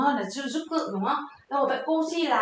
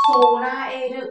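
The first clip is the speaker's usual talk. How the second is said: Sonaeru drawn out very slowly and loud, each syllable clear; teacher's careful demo of Sonaeru; slow model pronunciation; 7 dB louder, much slower than usual. Shown as a demonstration. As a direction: say Sonaeru slowly for students to copy. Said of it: "sẽ đọc là gì".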